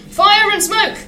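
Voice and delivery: high cry of dismay from an engine; alarmed exclamation. A narrator's reading. Thomas sinks into the hole.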